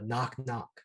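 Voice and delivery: usual speaking voice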